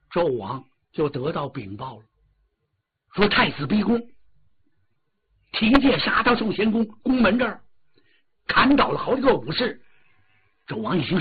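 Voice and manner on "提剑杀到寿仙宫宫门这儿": usual speaking voice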